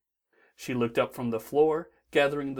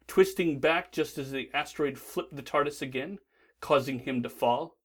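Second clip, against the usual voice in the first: usual speaking voice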